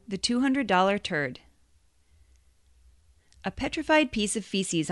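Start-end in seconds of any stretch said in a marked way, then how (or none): none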